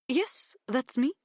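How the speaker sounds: lightly voiced Greek mother; Greek woman voice. Maria voiced.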